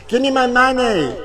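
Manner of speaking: high shouted picket chant; screaming for my money at the strike; mock strike scream. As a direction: yell it like a striker at a picket line. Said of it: "I'm gonna scream out".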